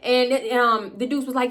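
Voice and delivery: ordinary talk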